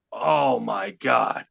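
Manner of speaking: slow, stunned, low gasp of awe; drawn out in disbelief; stunned oh my God. Retold or imitated. Seeing the packed garage, slow stunned awe.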